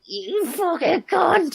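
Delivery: high, slow, drawn-out yell of abuse; a slow, high, furious shriek; furious shout. Retold or imitated. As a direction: scream the insult across the room. Retold fight, other lad's outburst.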